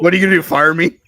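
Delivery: loud, high mocking challenge; defiant shout. Real, not acted. Mock defiance to co-host.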